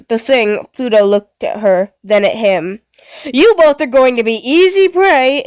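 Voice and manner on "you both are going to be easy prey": high, playful menace; gleeful creepy robot taunting prey; giggly robot villain. Acted. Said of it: "it giggled".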